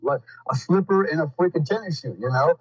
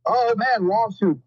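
higher, hammed-up fake injury whine; sneering impression of a lawsuit chaser; mock scheming plaintiff; 7 semitones higher than usual. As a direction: play the opportunist spotting a payday. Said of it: "that one guy to go".

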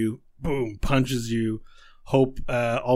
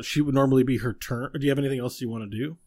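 usual speaking voice

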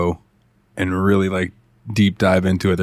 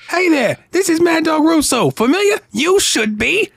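Mad Dog Russo impression. Imitating the sports podcast ad host, high and grating. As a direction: make it grate like nails on a chalkboard. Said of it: "his voice is like nails on a chalkboard".

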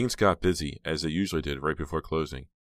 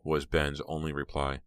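usual speaking voice

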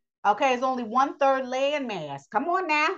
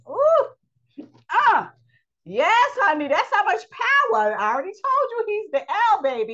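high, loud, worked-up exclaiming; excited; 10 semitones higher, 5 dB louder than usual. Real, not acted. Preacher getting carried away with excitement before the congregation. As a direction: let the excitement burst out, much higher. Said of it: "I'm getting excited".

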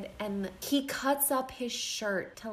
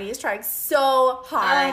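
gushing; 4 semitones higher, 7 dB louder, slower than usual. Real, not acted. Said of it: "Oh, bless his heart".

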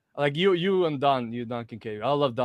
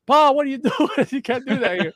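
much higher, louder scolding voice with laughter; laughing imitation of a trainer yelling; mock yell; 13 semitones higher, 6 dB louder than usual. John scolding the wrestlers in the gym, in a loud high voice. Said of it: "John would come yell at us".

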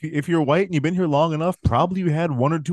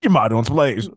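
Foxworthy bit; 8 semitones higher, 3 dB louder, slower than usual. Shown as a demonstration. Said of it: "Foxworthy bit".

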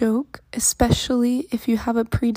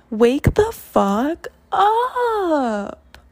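slow shouted command; 5 semitones higher, 5 dB louder, much slower than usual. Acted.

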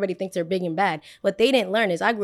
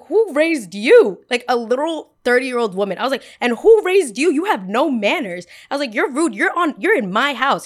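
high, loud, scolding rant; telling a rude boy off; indignant scolding; 5 semitones higher, 6 dB louder than usual. Retold or imitated. High, loud, scolding.

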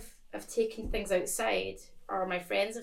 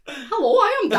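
loud, high comic entrance; hammed-up introduction; 8 semitones higher, 12 dB louder than usual. Candidate introducing himself to the panel, hammed-up introduction. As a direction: boom it out high as a mock announcement. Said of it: "if you went".

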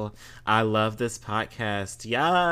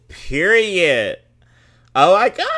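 loud, much higher exclamation; excited shout; 8 semitones higher, 13 dB louder than usual. Real, not acted. Host hyping Capricorns on the podcast, loud delighted shout. Celebrating being called magicians.

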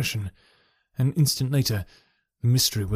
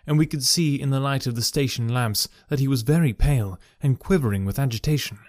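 ordinary delivery

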